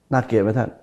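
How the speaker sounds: usual speaking voice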